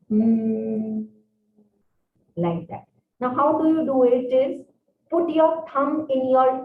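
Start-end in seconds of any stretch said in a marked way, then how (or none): 0.1-1.0 humming like a bee. Shown as a demonstration. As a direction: hum it like a bee. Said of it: "we have to make humming sound".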